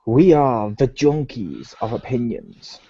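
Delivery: slow drawn-out host greeting; show host intro. Acted.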